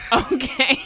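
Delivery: real laugh through the words, slow. Real, not acted. To co-host after a cheeky joke, laughing through it. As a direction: laugh while admitting the joke. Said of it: "I probably shouldn't have made that joke".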